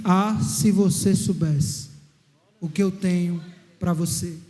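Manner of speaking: low, slow, hushed voice of God; God's inner voice, low and slow. Acted. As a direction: speak slowly and deep, like a voice from within. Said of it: "uma voz ecoou dentro de mim e disse assim".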